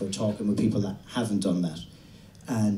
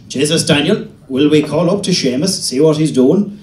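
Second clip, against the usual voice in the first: loud, higher Irish dad; dad's voice; 4 semitones higher, 9 dB louder than usual. Retold or imitated. Father calling his son to visit Seamus, loud and high. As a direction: boom it like an eager father.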